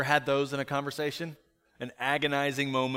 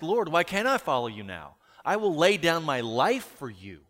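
higher, louder impassioned protest; Peter; 3 semitones higher, 5 dB louder than usual. Acted. Disciple protesting to Jesus, impassioned and insistent. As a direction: pledge loyalty insistently, pitch raised. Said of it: "Peter asked".

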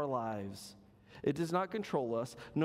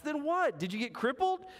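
high curious question, lightly voiced; a touch of puzzled man asking; voicing the man; 12 semitones higher, 3 dB louder than usual. Preacher voicing the man quizzing the dog, high and curious.

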